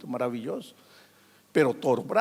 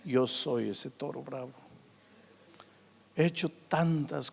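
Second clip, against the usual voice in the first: softer, lower, confessing in sorrow; remorseful old man; 4 semitones lower, 6 dB quieter than usual. Retold or imitated. Old man confessing to the pastor, softly and sorrowfully. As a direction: quietly own up to a lifetime of hurt. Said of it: "y me dice".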